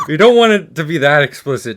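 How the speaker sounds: loud laughing protest. Real, not acted. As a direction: laugh the words out loudly. Laughing after friend's comment.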